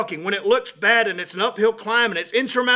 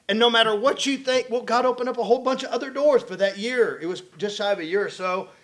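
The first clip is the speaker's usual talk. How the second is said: ordinary talk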